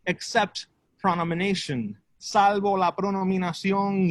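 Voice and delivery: measured spoken-word with long pauses; deliberate bilingual poem delivery; poetry recital. Acted. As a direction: recite it in short, separated phrases. Performs improvised bilingual poem.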